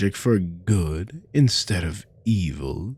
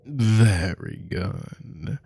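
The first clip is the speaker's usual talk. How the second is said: ordinary delivery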